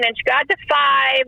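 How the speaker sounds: usual speaking voice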